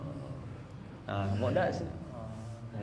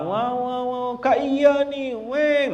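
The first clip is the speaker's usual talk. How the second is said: imitating a wailing child; 8 semitones higher, much slower than usual.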